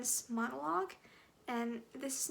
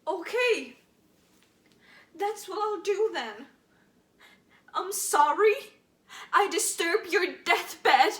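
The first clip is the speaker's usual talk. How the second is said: loud, biting sarcastic apology; bitter sarcasm; 5 semitones higher, 13 dB louder than usual. Acted. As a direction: spit out a mock apology. Monologue turns bitter.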